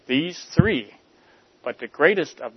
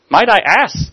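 higher, louder interested ask; new interest; 8 semitones higher, 9 dB louder than usual. Acted. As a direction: perk up with curiosity. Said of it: "said the clerk with new interest".

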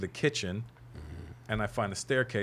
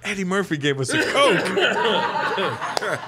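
slow, high, laughing through each word; laughing awe; 9 semitones higher, 7 dB louder, much slower than usual. Retold or imitated. Star-struck teen telling his friend about Eddie, laughing slowly. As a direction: savour it like a star-struck kid.

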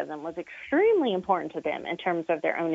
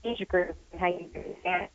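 patchy connection, fading in and out; bluetooth cutting out. Real, not acted. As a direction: voice breaking up over the line. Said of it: "You keep cutting out".

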